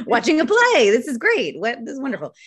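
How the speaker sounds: high, loud gushing about a play; gushing delight. Real, not acted. Guest gushing about theater from her bathtub, delighted. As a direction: swoon over it, pitch soaring.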